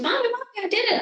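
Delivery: put-on high, thrilled little-girl voice; excited child voice. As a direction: burst with the pride of a kid who just nailed it. Daughter runs up all excited.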